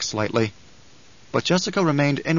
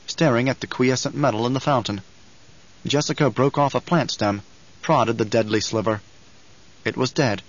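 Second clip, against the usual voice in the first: ordinary delivery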